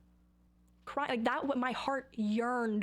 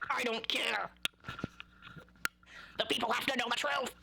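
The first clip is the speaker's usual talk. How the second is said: strained, hammed-up defiant outburst; mock defiance. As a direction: rasp out a mock rebellion. Defiantly acts out answering media handlers.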